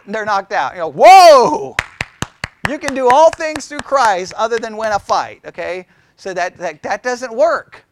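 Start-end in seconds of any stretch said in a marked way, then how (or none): 1.0-1.8 mock whoa. Fighter knocked out, mock exclamation.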